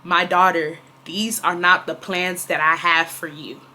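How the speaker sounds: low, slow and gentle; hushed, measured divine message; calm voice of God. Retold or imitated. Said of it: "it said it just like this".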